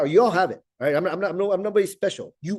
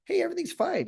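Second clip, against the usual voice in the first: acted reply in a much higher voice; high-pitched reassurance. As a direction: jump up high, insisting all is well. His acted reassurance to well-wishers.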